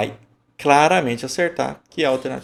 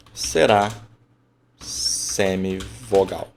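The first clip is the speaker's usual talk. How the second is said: "semivogal" bem arrastado e caindo no fim; enfático; much slower than usual. Shown as a demonstration. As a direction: estique "semivogal", bem devagar e firme. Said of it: "olha como eu estou sendo enfático". (ênfase lenta em "semivogal")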